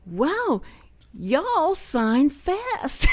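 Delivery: playing up a slow Southern reaction; drawn-out Southern amazement. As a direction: marvel slowly, stretching every word. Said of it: "and I said".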